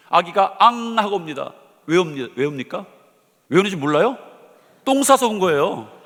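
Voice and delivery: rising preacher's fervor; building sermon. Acted. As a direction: press the point harder each round. Preaching builds on repeated example.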